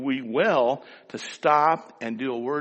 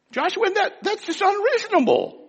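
much higher voice, imitating his mother. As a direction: play his mother, squeaky and indignant. Mother's voice, high put-on.

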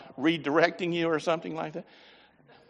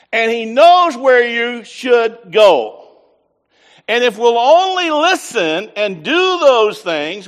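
slow, loud preaching with drawn-out words; preaching at full force; 4 semitones higher, 7 dB louder, slower than usual. Acted. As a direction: drive the message home to the congregation. Preaching the sermon's point.

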